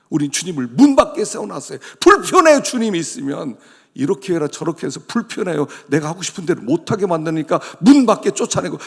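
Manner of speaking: usual speaking voice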